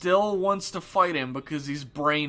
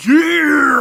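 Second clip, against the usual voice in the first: big eager cheer, much louder and higher; excited shout; 12 semitones higher, 15 dB louder than usual. Retold or imitated. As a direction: whoop with delight. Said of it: "they're both like".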